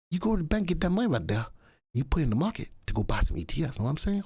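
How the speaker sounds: lightly put-on Southern accent. Shown as a demonstration. Host telling a viewer where to get cash, southern accent. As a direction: keep up a playful Southern accent. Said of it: "That's my southern accent".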